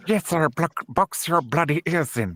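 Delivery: speelse imitatie van een Britse bokser; lightly voiced boxer, lisping and jovial; Chris Eubank impression. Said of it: "I sound like fucking Chris Eubank".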